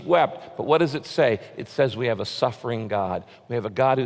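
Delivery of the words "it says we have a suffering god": ordinary delivery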